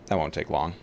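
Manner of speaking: ordinary delivery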